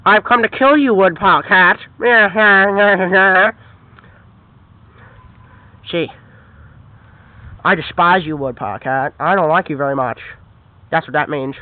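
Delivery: very low growly villain voice; menacing dog villain. Acted. Dog villain plotting against the cat, very low menacing voice. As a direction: drop way down like a brute dog.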